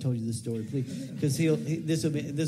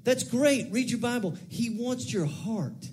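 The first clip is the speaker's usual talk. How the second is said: ordinary talk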